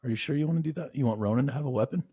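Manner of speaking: high-pitched, wary check; doubtful questioning. Real, not acted. Doubting the plan to arm kids.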